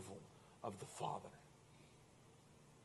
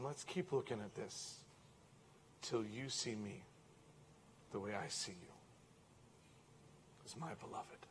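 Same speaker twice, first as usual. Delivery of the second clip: soft, fading to a whisper; tender hush; 3 semitones lower, 13 dB quieter than usual. Acted. Father invites his child, softer and softer. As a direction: speak it like a lullaby.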